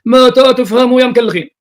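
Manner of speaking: high, loud, drawn-out rebuke; exasperated scolding. Real, not acted. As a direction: shout it slowly at people who refuse to understand. Exasperated scolding, question repeated louder.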